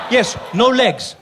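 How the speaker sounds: ordinary delivery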